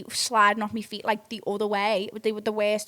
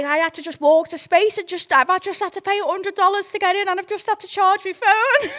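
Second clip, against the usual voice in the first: put-on whiny boyfriend voice; 9 semitones higher, 6 dB louder than usual. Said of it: "he was like".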